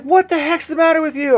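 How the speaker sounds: high-pitched mock scream at no extra volume; retold scream. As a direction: imitate an angry woman screaming, pitch way up. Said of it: "she's screaming at me".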